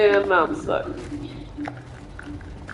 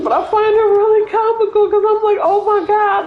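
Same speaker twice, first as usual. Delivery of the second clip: high, giggly retelling of her reaction; laughing at her own thought; 8 semitones higher than usual. Real, not acted. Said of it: "I don't know why I'm laughing about it".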